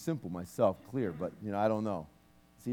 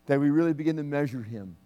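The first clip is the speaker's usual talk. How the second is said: fervent prayer. Acted. Minister praying aloud over the church, fervent prayer. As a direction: pray over the congregation with fervor.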